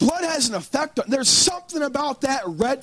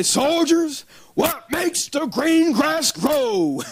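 slow, higher shouted command; gruff drill sergeant; 4 semitones higher, much slower than usual. Retold or imitated. Drill sergeant rousing tired recruits, gruff shout. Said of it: "that old gruff, uh, voice".

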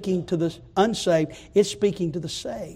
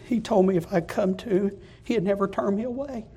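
ordinary delivery

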